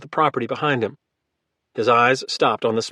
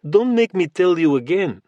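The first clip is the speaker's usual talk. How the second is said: put-on heavy, almost comic Russian accent, cold and flat; thick Russian accent; 6 semitones higher, 3 dB louder than usual. A narrator's reading. Russian giant ordering Logan off the property, thick Russian accent. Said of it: "The Russian accent was extremely thick now".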